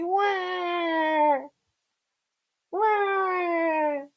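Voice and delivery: high, soft drawn-out fake wail; mock crying. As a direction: bawl like a pretend crybaby. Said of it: "I'm gonna cry".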